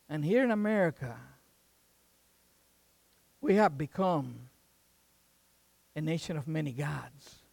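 soft, low, reflective appeal; solemn preaching. Real, not acted. Preacher asking the congregation to look inward, solemn. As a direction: bring it down to a grave, personal tone.

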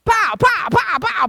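high, loud pow sound effects; loud cartoon hits; mock smack sounds. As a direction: smack out each blow. Beating the donkey hard.

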